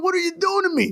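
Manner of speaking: imitating his own stunned reaction to the coach; stunned disbelief. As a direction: squeak out the question in disbelief.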